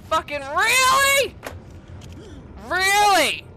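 high, loud disbelief; exasperated shout. Real, not acted. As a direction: yell it at the game, fed up. Frustrated with game weapon.

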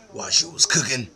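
ordinary delivery

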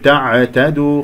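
drawn-out تعتدوا with a loose, bouncing ع; faulty bouncing ayn. Shown as a demonstration. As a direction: bounce the ع in تعتدوا as if adding qalqala. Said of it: "فيها قلقلة أو عائمة، تقول". (قلقلة العين في تعتدوا خطأً)

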